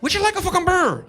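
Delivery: high, hammed-up Irish accent; mock Irish accent. Irish local offering him a beer, mock Irish accent. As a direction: twist it like a pub waiter in Ireland. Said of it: "I was in Ireland, same thing".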